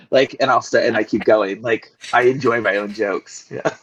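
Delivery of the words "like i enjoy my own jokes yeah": usual speaking voice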